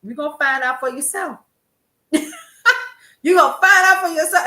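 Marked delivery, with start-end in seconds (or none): 2.1-4.4 louder, higher, laughing through it; mock-knowing mother, laughing; laughing mother quote. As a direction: laugh as you repeat mom's warning. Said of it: "she would shake her head and say".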